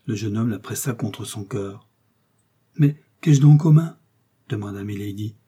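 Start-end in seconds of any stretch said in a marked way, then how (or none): 2.8-4.0 higher, louder bewildered question; mild alarm on discovering an injury; startled question. A narrator's reading. Rescued woman notices hurt wrists.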